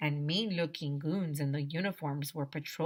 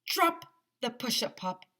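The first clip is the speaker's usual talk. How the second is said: shouted order; 6 semitones higher than usual. A narrator's reading. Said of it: "the voice shouted".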